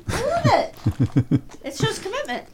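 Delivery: high, laughing through the words; giggling while she teases. Real, not acted. Teasing about dyed hair.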